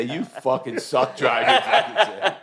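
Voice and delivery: teasing a friend, slow and laughing; laughing mock scold. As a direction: drag it out through a laugh. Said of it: "Lady's gonna say".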